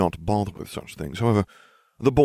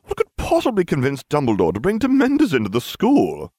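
ranting; 9 semitones higher, 6 dB louder than usual. A narrator's reading. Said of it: "I ranted".

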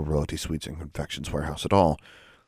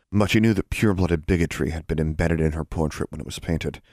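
ordinary delivery, nothing marked

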